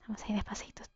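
hushed, cautious whisper; very soft, voiceless murmur. Real, not acted. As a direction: whisper it as if creeping along. Creeping along carefully in game.

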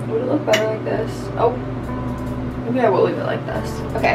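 ordinary talk, nothing marked

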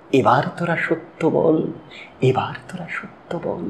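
recited refrain dying away to a hush; fading refrain. Acted. Closing the poem's plea for truth, fading away.

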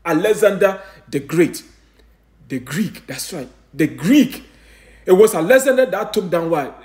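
usual speaking voice

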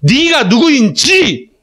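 drawn-out reading. Shown as a demonstration. Teacher shows students the other word order, drawn out. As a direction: lift and elongate each chunk. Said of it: "이렇게 읽어도 되고".

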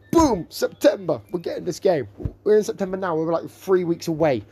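high, hyped-up shouting; excited. Real, not acted. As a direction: cheer it like great news. Excited about release date.

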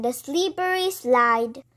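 ordinary delivery, nothing marked

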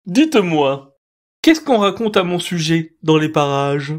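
butter the mouse up; smooth-talking owl. A narrator's reading. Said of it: "sur un ton patelin".

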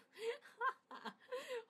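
cackling laugh up high; high wheezing laugh. Real, not acted. To viewers about the censor, a high wheezing laugh.

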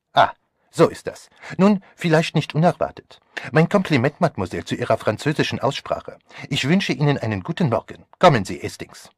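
put-on loud, high, theatrical French-accented farewell; emphatic, animated courtesy; Poirot. A narrator's reading. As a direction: gallantly take leave with a flourish. Plays Poirot.

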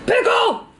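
high excited squeal; delighted little cry. Real, not acted. Delighted to find a pickle.

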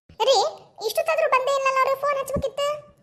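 high-pitched, anxious complaint; worried fretting. Acted. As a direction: fret in a high voice over someone being late. Anxious wait for late youngsters. (ಆತಂಕದಿಂದ ಏರಿದ ದನಿಯಲ್ಲಿ)

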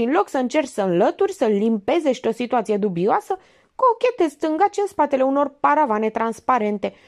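high, pressing detective voice; Minerva interrogating. A narrator's reading. Minerva grills the suspect actor, high and pressing.

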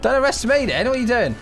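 normal talking voice

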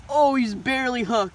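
loud, high cry of amazement; amazed exclamation. Real, not acted. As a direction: blurt it out, astonished at the narrow catch. Discovers fish barely hooked.

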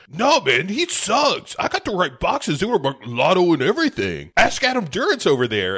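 put-on high, loud defensive bratty teen; whiny kid. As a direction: whine it like a caught thirteen-year-old. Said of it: "And Sam is like".

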